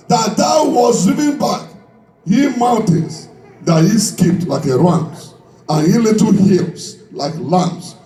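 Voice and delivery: ordinary talk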